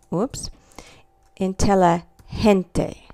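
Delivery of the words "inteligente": inteligente sounded out slowly, in-te-lee-hen-te; soft G. Shown as a demonstration. Teacher sounding out a word for students, slow soft G. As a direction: break up inteligente slowly, G as a breathed H.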